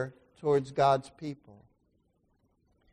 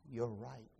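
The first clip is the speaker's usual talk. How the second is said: quiet admission; 7 semitones lower, 16 dB quieter than usual. Retold or imitated. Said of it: "and I said".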